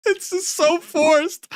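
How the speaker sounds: high, forced mock laugh. Mocking his laugh.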